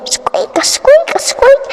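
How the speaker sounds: high squeaky mouse voice; mouse squeaks. Acted. Mice keeping Dick awake, high squeaking.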